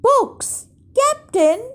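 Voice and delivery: very high henchman's call; high, sing-out reply. A narrator's reading. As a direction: call down to the captain in a high pitch. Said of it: "Pinky shouted down".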